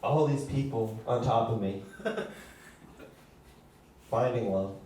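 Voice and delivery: low, soft, lonely lovelorn boat; deep, hushed pining ship; Love Boat impression. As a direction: brood softly about never being loved. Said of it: "my impression of the Love Boat".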